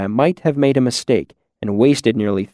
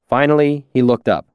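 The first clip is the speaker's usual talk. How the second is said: ordinary delivery, nothing marked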